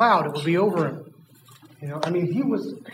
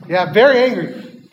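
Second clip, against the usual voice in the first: very angry; 2 semitones higher, 6 dB louder than usual. Shown as a demonstration. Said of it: "Kids, show me very angry face".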